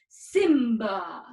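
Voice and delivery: put-on proud young king; proud deep Simba. Shown as a demonstration. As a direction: stand tall and call Simba. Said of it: "get deep in our voice".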